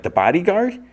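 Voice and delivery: high, loud disbelief; incredulous question. Acted. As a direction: query it in astonishment. Hawker astonished at who did it.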